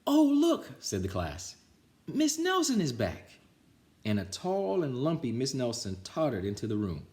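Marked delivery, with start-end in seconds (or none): 0.1-0.7 high, loud exclaim; excited kids. Acted. Class exclaims. 2.1-3.3 high, loud exclaim; excited kids. Acted. Class announces.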